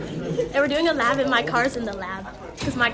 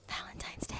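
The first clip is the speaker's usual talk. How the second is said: whisper; 17 dB quieter than usual. Pretend. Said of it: "It's an A S M R".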